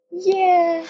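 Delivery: high, drawn-out mock agreement; retold verdict. Friend judging her accent, high drawn-out yeah.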